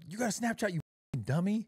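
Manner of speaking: high mocking friend voice; mock nagging. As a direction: throw her nagging back at her. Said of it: "you'd be like".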